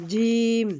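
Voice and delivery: জিম pressed firmly, vowel held long; firm jim. Shown as a demonstration. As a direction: hit জিম hard from mid-tongue. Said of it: "শক্ত করে উচ্চারণ করতে হয়". (শক্ত করে টেনে জিম)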